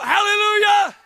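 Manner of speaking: high, loud hallelujah cry; shouted praise. Real, not acted. Preacher praising God before the church, high full-force shout.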